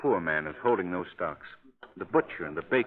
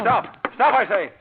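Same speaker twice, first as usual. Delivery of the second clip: higher, louder call to halt; commanding shout; 11 semitones higher, 6 dB louder, slower than usual. Acted. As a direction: yell after a fleeing figure. Calling after vanishing man.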